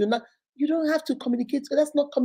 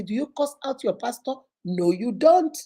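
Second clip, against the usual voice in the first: ordinary talk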